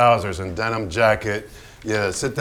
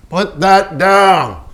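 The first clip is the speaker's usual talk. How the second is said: loud, higher, slow command; angry order; 7 semitones higher, 9 dB louder, much slower than usual. Acted. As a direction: spell out each word as a threat. Hans shouting at Pollock.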